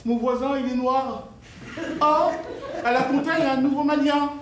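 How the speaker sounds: over-eager impression of small talk. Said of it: "on dit".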